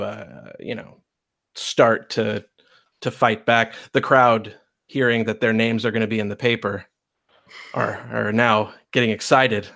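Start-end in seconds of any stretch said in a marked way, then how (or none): none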